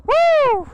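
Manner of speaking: a loud, high whoop of thrill; excited whoop. Real, not acted. Rider whooping at viewers after a hard rev, loud high whoop. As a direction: let out a real whoop as the bike pulls.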